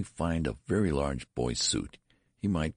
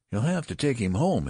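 insistent; 8 semitones higher, 5 dB louder than usual. A narrator's reading. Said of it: "insisted the nurse".

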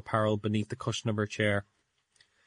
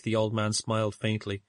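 plain reading voice

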